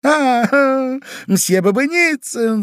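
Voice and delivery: very high, loud, drawn-out greeting; chirping lady. A narrator's reading. Lady greets another gentleman in the street, chirping. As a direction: chirp it like a delighted socialite. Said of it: "защебетала дама".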